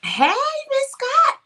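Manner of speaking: high, slow delighted hello; excited greeting. Real, not acted. Greeting a viewer who joined.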